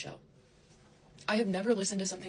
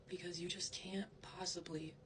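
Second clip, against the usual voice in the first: exhausted whisper; 10 dB quieter than usual. Real, not acted. Whispered line.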